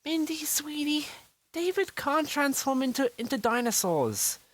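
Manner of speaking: calm her down kindly; mother soothing her scared daughter. A narrator's reading.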